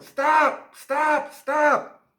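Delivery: high, loud repeated shouts; shouting through blowhorn. Acted. Principal shouting at school-eating monster, blowhorn shout. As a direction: bellow orders as if through a bullhorn. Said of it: "was shouting through his blowhorn".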